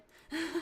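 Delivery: quick embarrassed chuckle; brief laugh at herself; short laugh. Real, not acted. Laughs at own stuttering.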